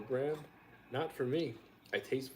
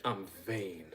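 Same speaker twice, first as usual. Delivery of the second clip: usual speaking voice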